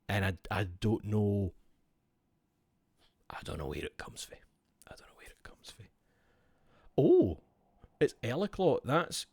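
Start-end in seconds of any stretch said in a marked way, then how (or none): 3.3-4.4 low, quick, quiet mutter; muttered bafflement. Real, not acted. Baffled muttering, first take. 4.9-5.9 barely audible whisper; baffled whisper. Real, not acted. Baffled repeat fading to whisper.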